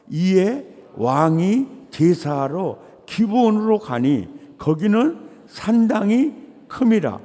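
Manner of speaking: loud preacher's scripture reading; loud, solemn Bible reading. Acted. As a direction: proclaim the verse to the congregation. Reads scripture aloud.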